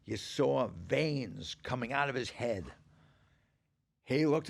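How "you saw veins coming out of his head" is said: ordinary talk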